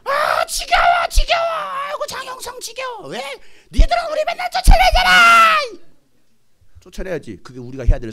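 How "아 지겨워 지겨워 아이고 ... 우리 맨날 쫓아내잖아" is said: extremely high, loud, drawn-out screech; wailing demon. Retold or imitated. Demon complaining to the preacher, shrieking wail. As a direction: imitate a demon whining in torment.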